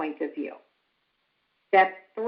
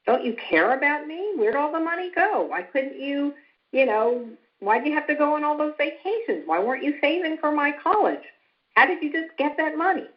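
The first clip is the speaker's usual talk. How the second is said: put-on higher, fast upset kid voice; imitating upset child; 7 semitones higher than usual. Shown as a demonstration. Parent imitating a child asking why the money is gone, mock upset. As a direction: voice the kid's hurt questions, mildly. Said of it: "I would be thinking".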